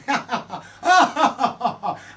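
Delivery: loud burst of laughter; real laugh. Real, not acted. Laughing at pub memory.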